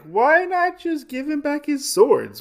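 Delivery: very high, put-on whiny plea; pleading henchman. Fuji's man urging him to give swords back, high mock plea. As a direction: squeak it out like a scared underling.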